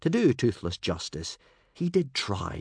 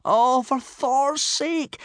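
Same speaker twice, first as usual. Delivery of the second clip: high, drawn-out dismayed gasp; 7 dB louder, much slower than usual. A narrator's reading. Hiccup watching his dragon charge the herd, gasping. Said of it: "gasped Hiccup".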